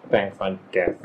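low, reluctant mumble of thanks; begrudging. Acted. Blue taking the fish from a carer, begrudging and low.

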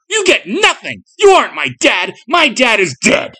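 high, loud, anguished shouting; child's furious, grief-stricken outburst; crying out. A narrator's reading. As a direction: shout down the impostor defiantly. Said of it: "cried Jason".

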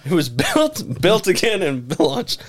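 mock reread, laughing through it; a giggly slow reread. Shown as a demonstration. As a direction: laugh while rereading the clumsy line. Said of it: "I didn't fix that".

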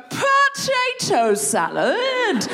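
an eager example, said slow and high; drawn-out demo. Shown as a demonstration. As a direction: stretch it out as an example. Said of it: "you're just gonna go".

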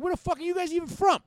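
loud, high-pitched indignant demand; retold angry challenge. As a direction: replay an outraged challenge to the cops. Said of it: "I was getting mad".

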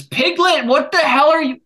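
high, loud outburst at a pet; sudden scolding. Real, not acted. Host to Piglet in the middle of a story, loud scolding.

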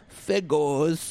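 mock affected singing voice, vowels swallowed; affected singer voice. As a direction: mimic a pop singer's affected vowels on one word. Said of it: "but then when she sings, she's like".

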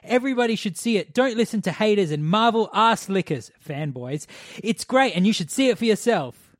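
loud, high, fanboy reading; all caps review. Retold or imitated. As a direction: read it like a shouting fanboy. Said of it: "So all caps?".

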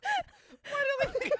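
high-pitched laughter breaking through the words; words squeezed out through laughter; laughing. Real, not acted. Cracking up while riffing with a friend, laughing.

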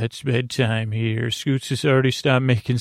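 normal talking voice

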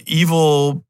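ordinary talk

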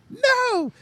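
very high, snippy refusal; mocking falsetto Iris. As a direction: squeal it like a stubborn diva. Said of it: "I was like".

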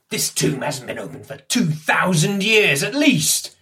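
heated outburst from a suspicious man; agitated protest. Acted. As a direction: build to an indignant high finish. Henry protests, gun raised at stranger.

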